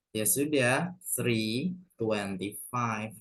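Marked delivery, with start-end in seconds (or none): 1.1-3.1 slow model reading. Shown as a demonstration. Teacher models the English time.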